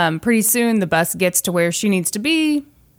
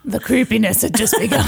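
mock-ominous voice breaking into laughter; lightly put-on spooky announcement, half laughing; Muppet-like menace; 2 semitones higher than usual. Host announces creepiness to come, giggly Muppet-like menace. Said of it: "I sounded like a Muppet".

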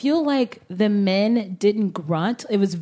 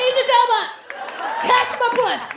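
tennis grunt; 12 semitones higher than usual. Pretend. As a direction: call it out high like a grunt. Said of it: "You're like".

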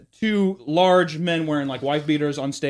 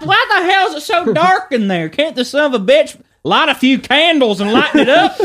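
Larry the Cable Guy voice; 12 semitones higher, 7 dB louder than usual. Retold or imitated. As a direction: bellow it like a country comic. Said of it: "my version of Larry the Cable Guy".

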